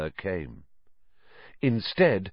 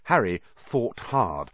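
ordinary delivery, nothing marked

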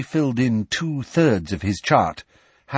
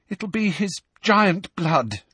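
high, slow and unsettled; distressed, drawn-out explanation; shaky; 9 semitones higher, slower than usual. A narrator's reading. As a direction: answer shakily, still upset. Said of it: "said Hermione shakily".

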